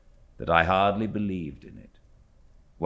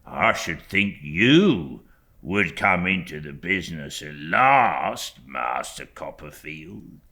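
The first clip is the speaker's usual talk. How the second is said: low, slow, humble Uriah voice; lightly voiced humble clerk; Uriah Heep; 3 semitones lower, slower than usual. A narrator's reading. Uriah speaking humbly to young David, low and slow.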